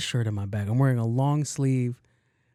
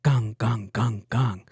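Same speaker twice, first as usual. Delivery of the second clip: alarm bell noise; 2 semitones lower, slower than usual. Retold or imitated. Comic retelling of the stuck elevator's alarm gong. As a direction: imitate the elevator alarm ringing. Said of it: "I hear".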